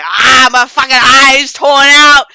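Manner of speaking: loud, slow pained groaning line; a hammed-up wounded cry; pained yell. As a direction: drag it out in pain. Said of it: "while he's in his little".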